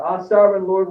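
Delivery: loud anguished cry to God; a dying man crying out. A narrator's reading. Dying leader crying out to God, loud cry. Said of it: "And he cried out".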